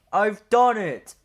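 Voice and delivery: loud, slow, high proud declaration. A narrator's reading. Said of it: "he said proudly".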